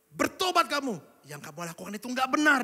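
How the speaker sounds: retold stern father's shout; father scolding. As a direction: hammer Bertobat like the father did. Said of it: "dia bilang gitu".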